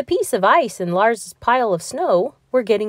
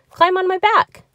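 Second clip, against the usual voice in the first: cheerful hippo; 12 semitones higher, 5 dB louder than usual. Acted. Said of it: "said Henry and laughed".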